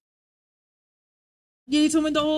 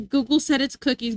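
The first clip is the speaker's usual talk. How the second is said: usual speaking voice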